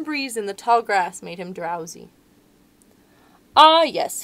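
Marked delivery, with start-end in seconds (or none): 3.6-4.2 lion voice. Acted. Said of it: "he thought".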